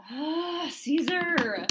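high, loud exasperated cry; loud frustrated wail; frustrated outcry. Real, not acted. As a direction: yelp his name in frustration. Frustrated outburst at the producer.

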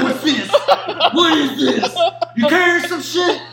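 mock guard yell. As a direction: bark it like a surprised guard doing a pat-down. Guard voiced during pat-down.

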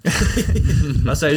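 usual speaking voice

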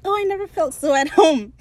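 high, loud gushing delight; delighted dandy. Retold or imitated. Steed arriving in the pirate republic, high gushing delight. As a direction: swoon over the place.

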